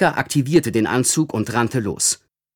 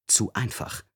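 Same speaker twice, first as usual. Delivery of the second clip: much softer, an inner voice; inner warning; 2 semitones lower, 10 dB quieter than usual. Acted. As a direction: murmur it like a hunch. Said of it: "warnte ihn sein Instinkt".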